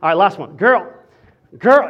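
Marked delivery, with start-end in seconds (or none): 0.6-0.9 loud, sassy pickup-line opener. Shown as a demonstration. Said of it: "You gotta say it with some sass". 1.6-1.9 loud sassy call, mock flirting. Shown as a demonstration. Pastor showing singles how to say pickup lines, sassy. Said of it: "you gotta say it like that".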